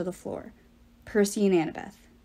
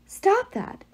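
shouting; 10 semitones higher than usual. Acted. Hazel cries out at giant.

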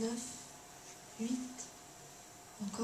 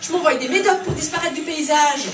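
loud, raging father, higher and louder; furious rant; 6 semitones higher, 8 dB louder than usual. Acted. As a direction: spit out the hatred of an outraged father. Father rants about the kidnapper.

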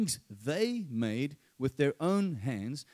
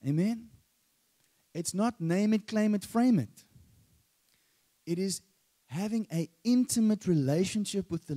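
raised preaching voice; 5 semitones higher, 3 dB louder than usual. Acted. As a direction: preach it with rising force.

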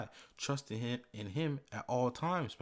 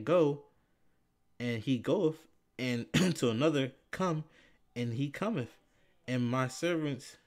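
scripture reading; 2 semitones higher, 4 dB louder than usual. Acted. Preacher rereading the centurion's words, commands ringing out. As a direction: read with authority, punching each command. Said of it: "I'm gonna read it again".

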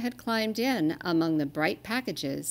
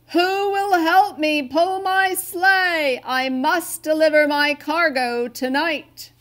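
booming high slow call, much louder; Santa bellowing; 9 semitones higher, 9 dB louder than usual. A narrator's reading. Santa calls for help across the world, slow booming bellow. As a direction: bellow it out slowly to the whole world. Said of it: "bellowed with all his might".

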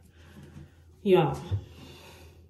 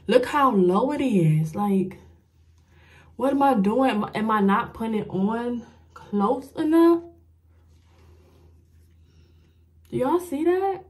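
exasperated; 4 semitones higher, 4 dB louder than usual. Real, not acted. Exasperated at crooked lash.

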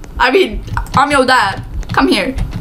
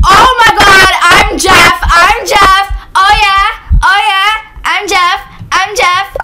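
higher, thrilled repeated exclamations; real excitement; 4 semitones higher, 4 dB louder, slower than usual. Real, not acted. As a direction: burst out celebrating, then ease off a little. Gets the killer role, celebrates.

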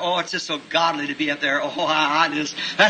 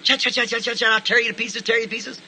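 higher, chattering mock chipmunk threat; 5 semitones higher than usual. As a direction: chatter like a cocky little chipmunk. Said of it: "a little chipmunk sitting over there going".

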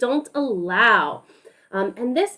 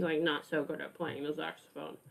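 much softer, lower, deflated; droopy doubtful; 9 semitones lower, 18 dB quieter than usual. Shown as a demonstration. Asking the children if Miles plays badly, low and deflated. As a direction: let "not so good" sag down glumly. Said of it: "Show me with your face".

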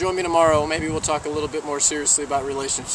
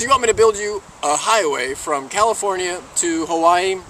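louder, mock-outraged disbelief; incredulous question; 3 semitones higher, 6 dB louder than usual. Genie questioning a highway to Hawaii, loudly incredulous. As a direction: repeat the absurd request back in disbelief.

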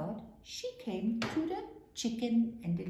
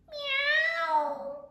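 high, drawn-out meow; cat's meow; 8 dB louder than usual. A narrator's reading. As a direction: call out a long high meow. Cat call heard on farm.

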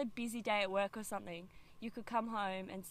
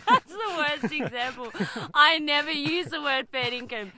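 loud, high, through real laughter; laughing; 5 semitones higher, 12 dB louder than usual. Real, not acted. Teacher laughing at their own bad example, loud and laughing. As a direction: protest while cracking up.

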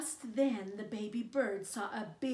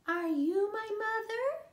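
much higher, hopeful chick voice; baby bird asking; 12 semitones higher, 8 dB louder than usual. Acted. Baby bird asking a kitten if it's his mother, high hopeful chick voice. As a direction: ask like a tiny lost chick. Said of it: "the baby bird asked a dog".

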